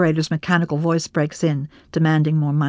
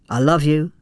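plain reading voice

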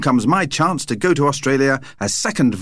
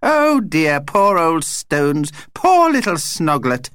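high, drawn-out vampire lament; pitying; 6 semitones higher, slower than usual. A narrator's reading. Vampire pities the Stones and baby, pitying. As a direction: commiserate slowly, pitched up.

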